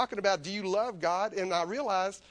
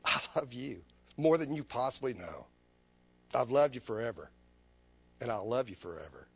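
God's voice; 5 semitones lower than usual. Retold or imitated. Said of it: "this is how he talks to me".

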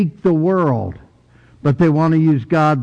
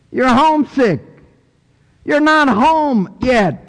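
high-pitched, raised sermon voice; impassioned preaching; 7 semitones higher than usual. Acted. Preacher telling a congregation they are not home yet, impassioned.

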